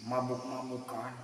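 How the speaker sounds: ordinary delivery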